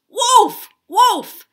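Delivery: high, full-voiced cry; loud alarm shout. Tom showing how to warn townsfolk, shouting. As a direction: shout it as loud as you can. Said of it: "as loud as you can".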